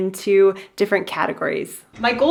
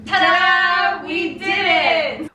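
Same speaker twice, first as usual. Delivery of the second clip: high proud reveal; excited ta-da; 8 semitones higher, much slower than usual. Real, not acted. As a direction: announce it with a flourish. Reveal of finished garage.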